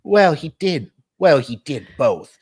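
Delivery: high, loud character voice. Acted. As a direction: come in high and loud, falling on each phrase.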